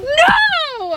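sudden high yelled denial; shouting no. Real, not acted. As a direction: blurt it out loud. Shocked denial to question.

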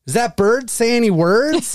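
high-pitched grandma asking again. Retold or imitated. Grandson mimicking forgetful grandma's bird question, mock-eager and high. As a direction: play grandma asking about the bird, forgetting she asked. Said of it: "she's like".

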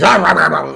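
loud, high mock feeding frenzy; imitated wild guzzling noises; frenzied gobbling. Castaway shoveling rations into his mouth, frenzied gobbling. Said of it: "he loses it".

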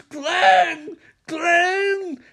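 high, loud mock scream; screaming Glenn. Nancy screams for Glenn, high mock scream. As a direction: yell his name in a panic. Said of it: "As Nancy screams".

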